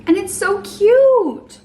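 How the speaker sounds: high, loud, slow gush. Real, not acted. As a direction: gush over it like it's adorable. Gushing over the pink drink.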